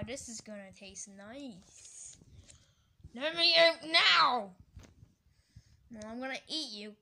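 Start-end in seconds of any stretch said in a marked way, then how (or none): 3.1-4.5 loud, high shout to be freed; urgent demand. Pretend. Ice cream about to be eaten, urgent shout. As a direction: yell to be let out.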